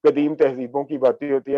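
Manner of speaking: usual speaking voice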